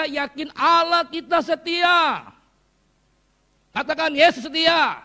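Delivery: loud, high fervent preaching; rally the congregation; preaching at full force. Acted. (khotbah keras dan bersemangat)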